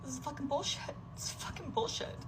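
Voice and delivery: high strained voice going to breath; strained despair. Real, not acted. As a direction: let it crack up into a breathy squeak. Final burst of despair.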